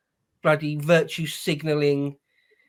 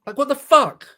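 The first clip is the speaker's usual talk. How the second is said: higher, louder disbelief; exasperated outburst; 7 semitones higher, 4 dB louder than usual. Real, not acted. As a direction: vent real exasperation at the mayor. Outraged reaction to mayor's answer.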